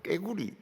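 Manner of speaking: 깨구리 with a tense kk onset; Gyeongsang 깨구리. Shown as a demonstration. Preacher mimicking the Gyeongsang way of saying frog: tense 깨구리. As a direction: say 깨구리 with a hard, tense first consonant. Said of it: "이렇게 합니다".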